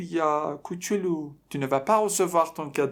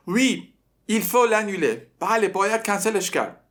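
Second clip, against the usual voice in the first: put-on dim-witted boy; 7 semitones higher, 4 dB louder than usual. Teacher mimics the dim boy answering the girl. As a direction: play the clueless boy agreeing dumbly. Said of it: "پسر یه خنگ".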